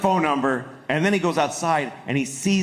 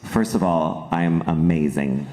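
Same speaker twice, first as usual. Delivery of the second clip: Matt Damon; 11 semitones lower than usual. Retold or imitated. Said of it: "he basically sat down, he's like".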